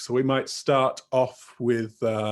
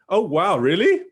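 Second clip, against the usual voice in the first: higher, louder show of surprise; amazed; 6 semitones higher, 5 dB louder than usual. Shown as a demonstration. Teacher models a basic interjection for students, amazed. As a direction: react with exaggerated, questioning amazement.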